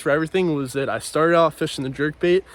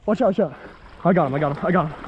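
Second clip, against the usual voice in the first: ordinary talk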